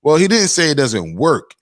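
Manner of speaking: ordinary talk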